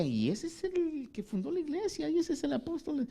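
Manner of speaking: high, sneering voice of the scoffers; mocking critics. Preacher voicing Corinthians who scorn Paul, high and sneering. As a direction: look down your nose at the apostle.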